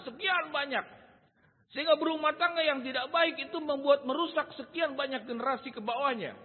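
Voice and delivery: high, loud sermon delivery; impassioned preacher at full volume; full-force preaching. Real, not acted. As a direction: drive it home to the congregation, loud and high.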